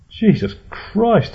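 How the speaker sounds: very high, drawn-out astonished exclamation; loud, high-pitched disbelief; stammering shock. A narrator's reading. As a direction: blurt it out high, aghast. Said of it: "stammered Jerry".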